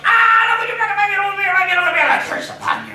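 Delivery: very high loud wail then curse; cursing dwarf. Acted. Dwarf curses the girls over his beard, shouting. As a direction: howl over the lost beard.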